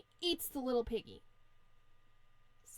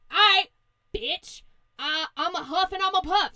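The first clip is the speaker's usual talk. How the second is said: loud, higher-pitched mock threat; threatening wolf; 6 semitones higher, 11 dB louder than usual. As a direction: shout it like a fed-up comic wolf. Wolf threatens the pig in retelling.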